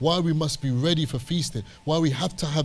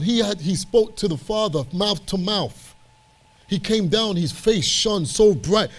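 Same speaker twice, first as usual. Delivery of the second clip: impassioned preaching; 5 semitones higher than usual. Real, not acted. Preacher holding Moses up to the congregation, impassioned. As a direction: preach it with mounting conviction.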